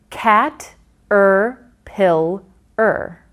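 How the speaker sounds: "cat, er, pill, er" as four separate beats; separate syllables. Shown as a demonstration. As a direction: lay out each piece before blending. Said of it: "we blended to form a word".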